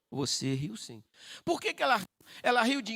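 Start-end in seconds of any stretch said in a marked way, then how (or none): 0.1-1.0 low, matter-of-fact insistence; firm contradiction. Acted. Angel answering Sara's second denial, low firm insistence. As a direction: drop the pitch and hold firm.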